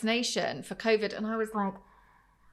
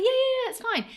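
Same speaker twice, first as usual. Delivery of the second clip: mock nurse; 13 semitones higher than usual. Mimicking dismissive nurse.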